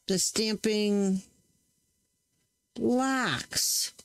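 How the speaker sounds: slow, careful "the stamping blocks" with "blocks" drawn out; emphasizing blocks. Real, not acted. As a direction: lean on "blocks" and take your time. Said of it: "emphasizing the word blocks".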